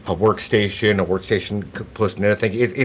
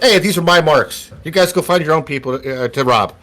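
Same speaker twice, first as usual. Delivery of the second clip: put-on high, loud kid warning others off; chiming out very loudly; 6 semitones higher than usual. Kid claiming his marks before the beggars, loud. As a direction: call it out, pitched up, then easing. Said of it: "the kid chimes out very loudly".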